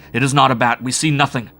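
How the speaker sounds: panicked insistence; frantic denial. A narrator's reading. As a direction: stress on not, frantic. Narrator shrieking denial.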